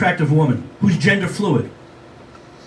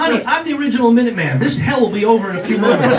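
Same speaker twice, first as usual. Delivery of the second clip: mock boast; 6 semitones higher than usual. Himself reassuring her.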